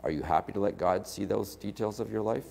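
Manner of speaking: ordinary talk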